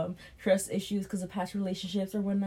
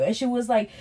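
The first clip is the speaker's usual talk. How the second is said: normal talking voice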